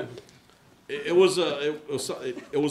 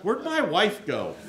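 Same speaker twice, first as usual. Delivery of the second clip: laughing; 6 semitones higher, 3 dB louder, slower than usual. Real, not acted. Joking about his wife.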